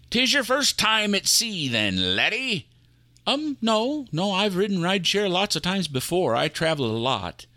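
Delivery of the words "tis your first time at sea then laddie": put-on light pirate accent, a friendly question. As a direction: quiz the new crewman in a pirate accent. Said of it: "that's an odd accent".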